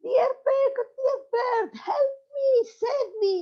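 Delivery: donkey stuck in the well screams to friends for help. Acted. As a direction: wail for rescue from the well. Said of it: "he started to scream".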